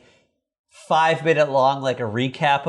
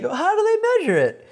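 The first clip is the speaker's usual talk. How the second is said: put-on much higher, curious little boy; Bobby voiced. Bobby asking about the Grand Canyon depth, high kid voice. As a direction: ask it like a wide-eyed kid. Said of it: "And Bobby's like".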